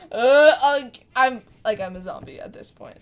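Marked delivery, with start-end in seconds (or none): none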